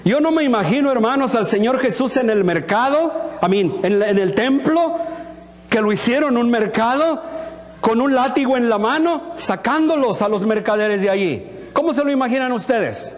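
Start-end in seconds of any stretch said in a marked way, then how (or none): none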